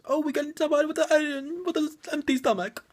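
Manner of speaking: mock a Jamaican accent, badly and high. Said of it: "That's a terrible accent".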